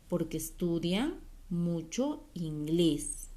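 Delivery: measured, lilting verse recital for little ones; poem reading. Acted. Teacher reads a short poem to the class, poem reading. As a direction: read a children's poem with stretched key words. Leyendo este poemita.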